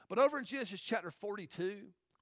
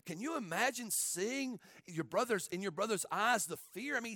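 ordinary talk, nothing marked

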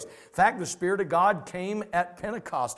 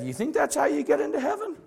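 higher, laughing through the question; laughing disbelief; 9 semitones higher than usual. Retold or imitated. Pastor questioning the student, laughing in disbelief. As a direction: ask it amused and incredulous. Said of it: "And I said".

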